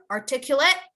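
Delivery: articulate: said ar-TIK-yoo-layt, clear ending; model for repeating. Shown as a demonstration. Teacher giving the class articulate to repeat. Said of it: "Repeat after me, everyone".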